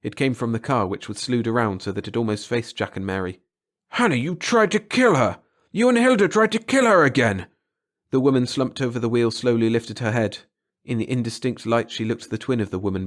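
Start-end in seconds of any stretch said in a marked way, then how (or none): 3.9-7.5 high, loud, shocked accusation; horrified outcry. A narrator's reading. Husband horrified to find his sisters tried to kill, crying out. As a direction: cry out in disbelief at the sisters. Kesserik shocked at attempted murder.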